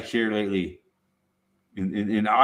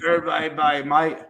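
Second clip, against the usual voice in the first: put-on playful Aussie accent, pitched up; best Australian accent; 5 semitones higher than usual. Repeating the bay's name for laughs, Australian accent. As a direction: ham up the Australian vowels. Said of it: "That's my best Australian accent".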